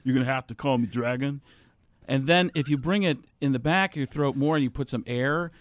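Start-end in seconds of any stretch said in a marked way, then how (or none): 0.0-1.4 John C. Reilly voice. Acted. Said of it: "becomes John C. Reilly".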